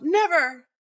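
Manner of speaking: loud, high shriek; scream of refusal. Retold or imitated. Boy's last defiant scream before giving in, shrieking. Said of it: "another scream of".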